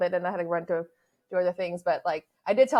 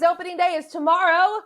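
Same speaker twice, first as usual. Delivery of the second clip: excited; 9 semitones higher, 7 dB louder than usual. Real, not acted. Hyped intro before opening day.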